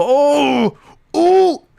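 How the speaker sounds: high, loud mock outcry, hammed-up; mock Dean impression. Streamer mimicking Dean reacting to Bag, high mock outcry. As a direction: squeal it like a cartoonish friend. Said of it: "I don't know what voice I'm going with".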